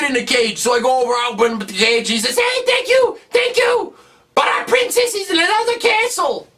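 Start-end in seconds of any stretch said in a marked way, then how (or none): 2.4-6.5 put-on very high, chirpy child voice; high little kid. Freed kid tells Mario, high childlike. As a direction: thank him in a tiny bright kid voice.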